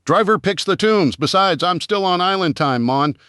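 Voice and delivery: high, playful mock Jamaican accent. Driver teasing the back-seat passenger in a mock Jamaican accent. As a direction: tease in a put-on Jamaican lilt. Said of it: "provided his best Jamaican accent".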